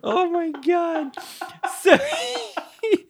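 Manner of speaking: high, wheezing, crying with laughter; real laughing fit, voice high and breaking; helpless laughter. Real, not acted. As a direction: laugh so hard the words squeak out high. Cracking up retelling absurd scene.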